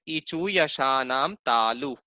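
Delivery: slow, careful teaching chant; slow recitation. Shown as a demonstration. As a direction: recite the sutra slowly, syllable by syllable. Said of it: "ये नियम क्या है?".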